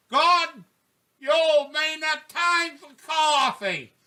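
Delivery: high, slow, drawn-out call; wife yelling. Retold or imitated. Wife waking her husband for coffee, high-pitched yelling. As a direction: holler it high to wake him. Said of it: "my wife yelled loud enough".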